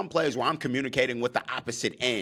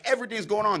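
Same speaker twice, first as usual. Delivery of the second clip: normal talking voice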